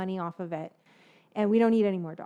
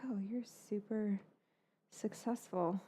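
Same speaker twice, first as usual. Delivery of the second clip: hushed, voiceless whisper; 12 dB quieter than usual. Real, not acted. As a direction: whisper it like sharing a secret. Telling gossip about a neighbor.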